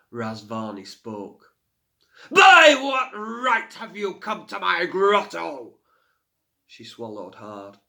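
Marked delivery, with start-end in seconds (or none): 2.3-5.8 loud, high, slow fiery bellow; roaring fire spirit. A narrator's reading. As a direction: roar it like a forest fire. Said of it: "In a voice like the roar of a forest fire".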